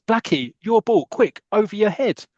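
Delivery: high, drawn-out urgent call; acted shout. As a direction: call out a warning to a teammate. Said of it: "someone shouts".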